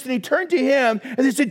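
usual speaking voice

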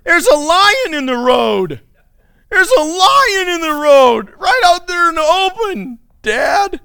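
high, loud hammed-up wail of alarm; whining sluggard. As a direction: wail a lame excuse like a lazy kid. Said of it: "The sluggard says".

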